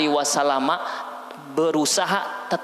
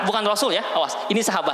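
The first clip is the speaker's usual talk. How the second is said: ordinary talk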